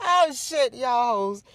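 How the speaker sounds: loud high cry of disbelief; excited outburst. Real, not acted. Vlogger cracking up at the rivals, loud and high. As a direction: let it burst out, high and loud.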